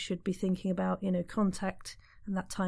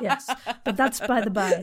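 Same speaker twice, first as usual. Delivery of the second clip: laughing through words; 5 dB louder than usual. Real, not acted. Laughing at own wine joke.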